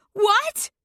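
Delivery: high, loud shocked shriek. A narrator's reading. Mother shrieking in shock at the confession. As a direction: shriek in horror. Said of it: "Mom shrieks".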